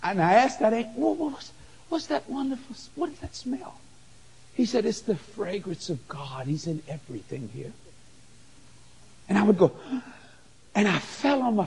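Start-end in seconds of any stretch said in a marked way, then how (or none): 1.0-3.8 hushed, stammering question, softer; stammering awe. Retold or imitated. Awed question to the angel. 4.9-7.7 hushed angel voice. Retold or imitated.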